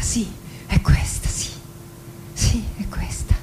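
fading into an unvoiced whisper. Woman convincing herself of her beauty, whispering. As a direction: let the yes dissolve into a whisper.

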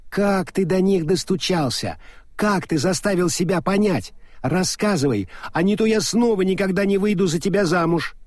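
high, insistent, scolding woman; Adora Belle, demanding. A narrator's reading. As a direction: pound out the questions, pushing. (высокий требовательный женский голос)

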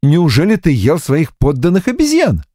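hammed-up horrified question; mock horror. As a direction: fake shock, over the top. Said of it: "Наигранно ужаснулась девушка".

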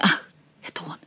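soft gasped whisper; frightened whisper. A narrator's reading. Diana hearing the voice she had forgotten, startled whisper. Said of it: "прошептала Диана".

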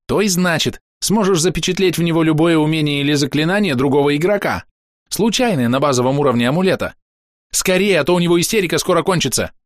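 high, animated explanation; impatient insect helper; chirping cricket, urgent. A narrator's reading. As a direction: explain eagerly in a little cricket voice.

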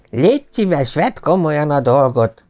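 sharp rebuke. A narrator's reading. Father scolding his son Pet, a mild stern snap. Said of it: "rivalt rá az apja".

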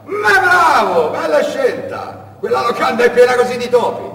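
very high, loud, slow mocking bravo; the smuggler mocking the boy's choice of inn; sneering smuggler. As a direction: drag out the fake praise.

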